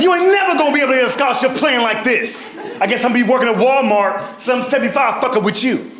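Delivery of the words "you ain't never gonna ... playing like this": higher, scolding mom voice; a touch of the nagging mother. Retold or imitated. Mother scolding her son about his scholarship, mildly high. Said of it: "She's like".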